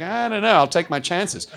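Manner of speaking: imitating a reluctant, noncommittal kid; dismissive boy. Acts doubtful boy brushing off warning.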